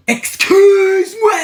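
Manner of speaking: slow loud high shriek; scandalised, stretch every syllable; mock-offended cry; outraged excuse me. Outraged at crush reveal.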